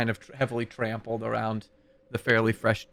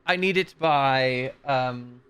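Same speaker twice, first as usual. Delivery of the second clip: abbot voice; 3 semitones higher than usual. Acted. Abbot demands wedding dress.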